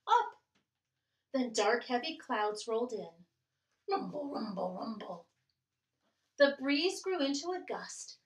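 put-on lively read-aloud for children; storytime reading. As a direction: read it like a picture book to kids. Reading picture book aloud.

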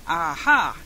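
short exclamation with a touch of triumph; triumphant cry. A narrator's reading. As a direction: cry out lightly, as if proven right. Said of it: "he cried as soon as he saw".

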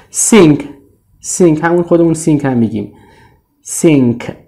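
ordinary talk, nothing marked

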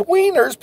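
"wieners" said high with a long ee; "wieners" stretched high for the pun; wieners, not winners. Shown as a demonstration. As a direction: punch "wieners" as wee-ners, a jokey swap. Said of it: "not winners".

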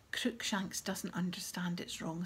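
ordinary delivery

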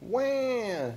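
high-pitched infant wail; baby ghost yell. A narrator's reading. Baby ghost yelling at Gus, high baby wail. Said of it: "yelled baby ghost".

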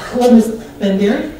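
normal talking voice

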